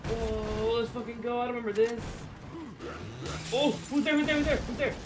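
high and loud, all keyed up; frantic excitement. Real, not acted. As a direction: wound up and jumpy. Excited then frightened during attack.

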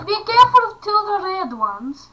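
put-on high voice. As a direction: say it in a character voice. Repeating line in a voice.

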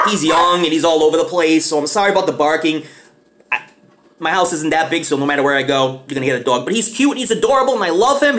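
ordinary delivery

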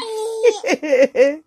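high burst of laughter; laughing. Real, not acted. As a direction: crack up laughing. Grandma laughing at baby.

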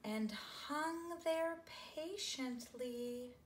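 very slow, patient delivery; slow lingering read; drawn out. A narrator's reading. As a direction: stretch every word out patiently. Slowed for patience.

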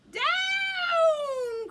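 loud, high, drawn-out shout; witch's cry. Acted. Witch ordering the broomstick to the ground, crying out. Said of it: "cried the witch".

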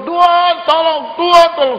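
high, loud cry to the Lord; desperate prayer. Acted. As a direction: beg for help from the rooftop. Praying on the roof.

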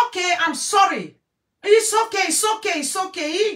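very high, loud near-shout; heated insistence. Real, not acted. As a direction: shout down the doubters. Heated defence of the man.

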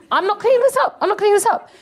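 high-pitched protest, repeated; insistent refusal. Acted. Comic voicing a woman refusing the mess, high insistent protest.

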